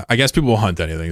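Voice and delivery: ordinary delivery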